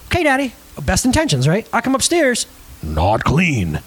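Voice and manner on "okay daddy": put-on higher-pitched little boy's reply; little kid agreeing. Young son answers dad's request to clean, agreeable kid voice.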